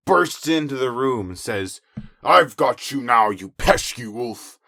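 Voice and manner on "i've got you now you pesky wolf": loud, slow heroic declaration; a hero arriving in triumph; louder, slow lumberjack voice. Acted. Lumberjack bursts in.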